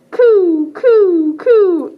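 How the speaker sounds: loud cooing pigeon sounds; pigeon coos. Acted. Librarian cooing as the pigeon flock for the kids. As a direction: coo loudly like a pigeon flock. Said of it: "they all say".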